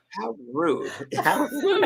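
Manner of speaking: mock offended, laughing through it; laughing indignation. Reacting to Oprah's rudeness, laughing mock offense. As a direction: scoff with a laugh. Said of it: "I'm like".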